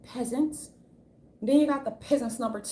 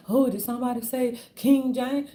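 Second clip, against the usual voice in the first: a put-on, louder LeBron voice; mocking LeBron; 3 semitones higher, 5 dB louder than usual. Speaker mocking LeBron James fishing for praise, in a put-on voice. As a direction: preen like a star hearing his title. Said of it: "'cause he be like".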